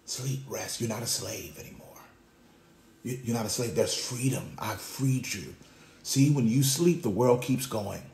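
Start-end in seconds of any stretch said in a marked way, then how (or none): 0.0-5.5 whispering. Acted. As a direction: drop to a soft, low whisper. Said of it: "he whispers".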